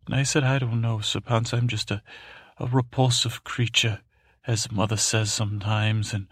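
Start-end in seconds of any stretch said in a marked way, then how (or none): none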